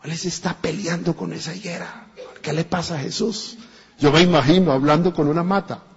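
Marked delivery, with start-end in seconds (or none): 0.0-3.6 gossiping disciples. Retold or imitated. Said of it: "los discípulos lo vieron".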